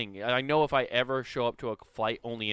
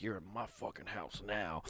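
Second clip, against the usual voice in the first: mock tough driver; 5 semitones lower, 14 dB quieter than usual. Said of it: "they're like".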